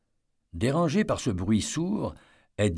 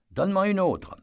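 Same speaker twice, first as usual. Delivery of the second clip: much higher, eager ask; eager request; 10 semitones higher, 3 dB louder than usual. A narrator's reading. Eddie asks the guard for another stone, high and eager. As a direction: ask for one more, keen.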